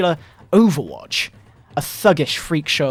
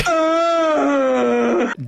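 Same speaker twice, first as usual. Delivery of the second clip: high drawn-out cackling laugh; real laughter breaking through; long laugh; 10 semitones higher than usual. Real, not acted.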